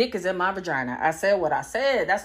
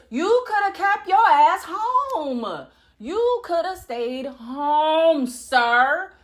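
sassy scolding; 8 semitones higher, much slower than usual. Retold or imitated. Scolding the boyfriend.

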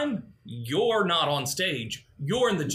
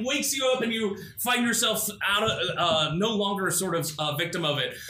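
ordinary delivery

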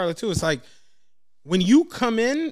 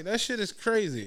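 ordinary talk

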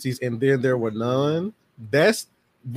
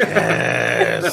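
low rasped, drawn-out yes; creepy growled delight; mock demonic glee; 14 semitones lower, 3 dB louder than usual. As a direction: croak it out like a little horror fiend. Gleeful child horror fan voiced.

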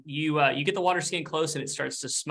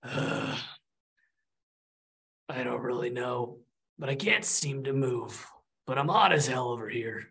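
weary lava ghost; 2 semitones lower than usual. Acted. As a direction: drag out each word, listless. Lava ghost speaks slowly.

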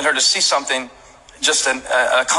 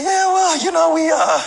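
much higher, mock sheepish voice of Wade; 11 semitones higher than usual. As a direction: play Wade apologising with a hammed-up high voice. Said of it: "basically is what he said".

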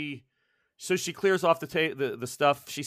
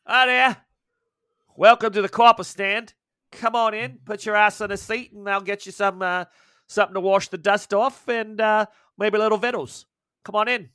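high, loud Australian barmaid voice; cheerful outback barkeep in Australian accent; Aussie barmaid; 6 semitones higher, 7 dB louder than usual. Acted. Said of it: "she's like".

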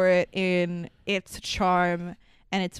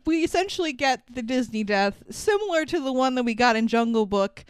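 ordinary talk, nothing marked

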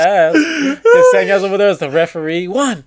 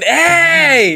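mock shout; 9 semitones higher than usual. Luz bursting in on the fight, mock shout. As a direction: call it out to break it up. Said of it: "Luz would come in".

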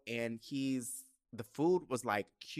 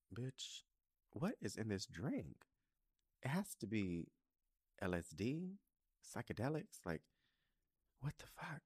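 inner thought; 8 dB quieter than usual. Retold or imitated. Inner thoughts on a weird date.

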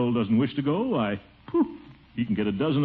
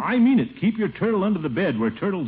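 sharp high stern order; 8 semitones higher, 4 dB louder than usual. Acted. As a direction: put your foot down hard. Uncle scolding nephew.

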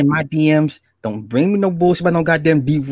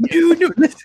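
ordinary talk

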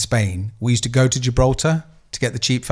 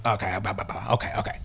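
a dismissive, near-whispered okay, okay; dismissive mutter; 4 semitones lower, 10 dB quieter than usual. Retold or imitated. Aggro man waving off the volunteer, muttering and dismissive. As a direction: brush her off under your breath.

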